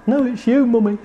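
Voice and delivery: put-on high, eager child voice; child showing mum a drawing, high and eager; small child. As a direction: play a proud little kid. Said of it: "And you go".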